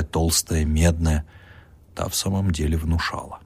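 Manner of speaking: ordinary delivery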